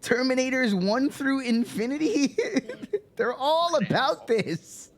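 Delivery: high, drawn-out incredulous protest; mock outraged yelling. Heckler listing Terminator films, mock outraged yelling. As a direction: protest slowly in a high yell.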